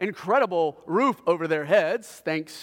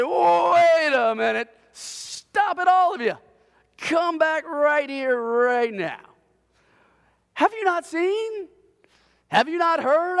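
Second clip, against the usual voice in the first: higher, louder, slow mock scolding; fed-up dad; 7 semitones higher, 3 dB louder, slower than usual. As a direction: lay down the law like an exasperated father. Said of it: "I said".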